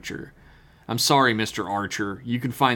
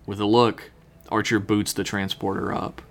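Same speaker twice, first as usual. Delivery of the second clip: normal talking voice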